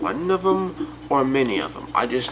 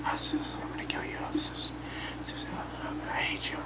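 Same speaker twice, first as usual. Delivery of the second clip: soft menacing whisper; demon whisper; 14 dB quieter, faster than usual. Retold or imitated. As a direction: breathe it like a demon in the dark. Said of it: "just pretend it's in a demon voice".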